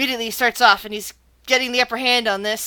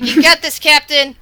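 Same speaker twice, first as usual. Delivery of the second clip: loud, higher crowd supporter; cheering on; 4 semitones higher, 10 dB louder than usual. Acted. Crowd cheering on their captain, loud higher call. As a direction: call out encouragement over a crowd. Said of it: "They've been cheering very loudly".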